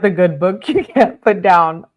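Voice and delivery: laughing through the words. Real, not acted. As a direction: giggle while saying it, amused. Laughing at the drama.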